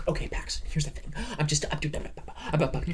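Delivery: a touch of flustered actress parody; imitating film actress. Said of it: "this whole movie, she's like".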